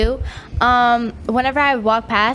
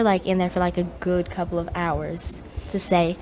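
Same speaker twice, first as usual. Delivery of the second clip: ordinary talk, nothing marked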